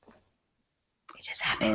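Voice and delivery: slow, hushed whisper; sheepish whisper. Real, not acted. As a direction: whisper a small helpless excuse. Sheepishly explaining the sudden accent.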